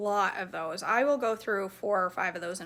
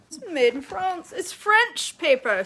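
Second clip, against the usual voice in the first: high, loud put-on French accent; hammed-up French accent, pitched up; mock French accent; 7 semitones higher, 6 dB louder than usual. Vlogger showing off her parchment paper, playful fake French accent. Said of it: "the French accent goes".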